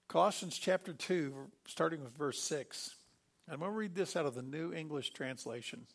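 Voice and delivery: ordinary talk, nothing marked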